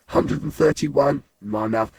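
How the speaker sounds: usual speaking voice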